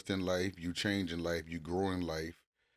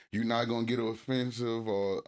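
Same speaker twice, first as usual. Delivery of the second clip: ordinary talk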